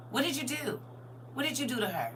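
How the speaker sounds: high, hushed mock interrogation; suspicious questioning. Grandmother asking daughter what she did, mock suspicion.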